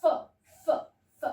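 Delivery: crisp isolated फ sound, repeated with pauses; phonics sound demo. Shown as a demonstration. Teacher to children showing the फ sound, crisp and isolated. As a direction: say फ alone, breathy and short, again and again. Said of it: "फ का sound".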